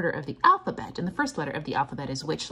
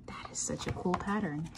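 awed whisper; 7 dB quieter than usual. Real, not acted. Teacher admiring the sun rays, awed whisper. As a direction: hush it with wonder. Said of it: "whispered aside".

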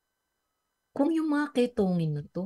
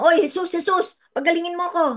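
a much higher, louder bossy call; demanding shout; 11 semitones higher, 7 dB louder, faster than usual. Retold or imitated. Preacher mocking lepers who would demand healing, loud shout. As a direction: shout at Jesus as if ordering him. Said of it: "Hindi sila sumigaw na".

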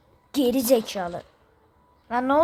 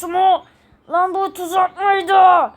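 higher, louder, slow stunned shout; shocked shout; 6 semitones higher, 8 dB louder, slower than usual. Acted. Character realizing it was a trap, shocked shout. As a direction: cry out at the trap.

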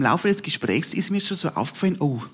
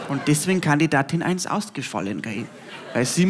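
normal talking voice

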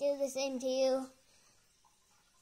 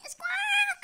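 high shouted bird squawk; loud parrot squawk; 7 dB louder than usual. Acted. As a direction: screech it like a parrot.

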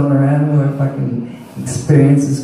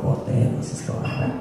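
muttered aside; 9 dB quieter than usual. Retold or imitated. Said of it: "She's just like".

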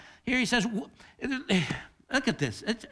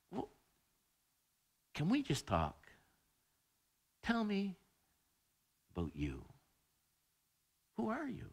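put-on soft child's plea; 11 dB quieter than usual. Child asks the parent again.

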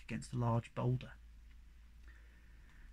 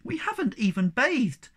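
high, hurried pleading; begging; 7 semitones higher, 4 dB louder than usual. A narrator's reading. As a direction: plead eagerly to stay. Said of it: "begged the Snork Maiden".

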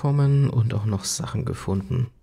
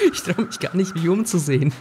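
nervous laughing; 6 semitones higher than usual. Real, not acted.

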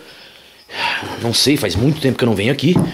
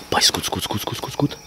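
whispering; 5 semitones lower, 7 dB quieter, much faster than usual. Real, not acted. Hearing a noise in the dark.

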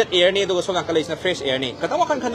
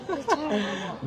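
soft, high little laugh; quiet high-pitched chuckle; 8 semitones higher, 11 dB quieter than usual. Real, not acted. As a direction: let out a small, high laugh. Laughs while questioning the pupils.

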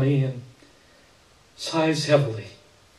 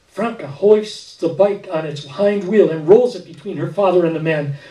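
ordinary delivery, nothing marked

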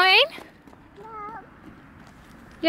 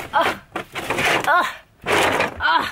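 usual speaking voice

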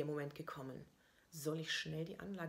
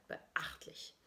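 whispered, dry approval; whispered aside; 4 dB quieter than usual. A narrator's reading. Narrator whispering approval of the worker. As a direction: breathe it out as a quiet aside.

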